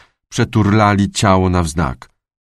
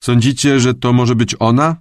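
lifted, louder detective voice; questioning detective; 6 semitones higher, 4 dB louder than usual. A narrator's reading. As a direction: ask the room, half entranced. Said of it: "spytał w przestrzeń".

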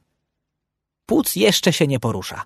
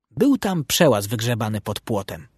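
usual speaking voice

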